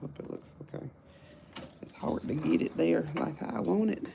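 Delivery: normal talking voice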